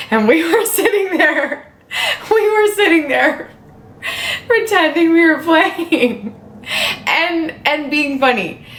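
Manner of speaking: high, squeaky, laugh-tinged admission; laughing confession. Real, not acted. Streamer admitting the faked gameplay to viewers, high laughing confession.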